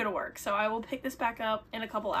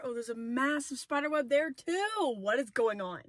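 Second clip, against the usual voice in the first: surprised; 4 semitones higher, 5 dB louder than usual. Real, not acted. Finds another spider web.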